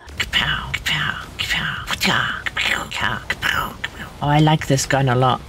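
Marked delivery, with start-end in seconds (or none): 0.1-4.1 whispered gunshots. Pretend. Whispered gun sound effects.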